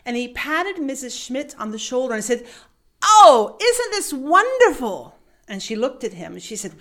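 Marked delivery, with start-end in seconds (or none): 3.0-5.2 high, loud, delighted gasp; thrilled manager. Retold or imitated. Manager delighted to finally use the check form, high and loud. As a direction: gush with joy.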